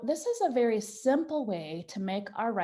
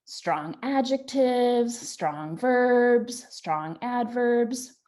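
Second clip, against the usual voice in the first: slow, emphatic listing; stressed list; 3 semitones higher, 5 dB louder, much slower than usual. Shown as a demonstration. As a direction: lean on each word strong. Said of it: "dress up your writing by using".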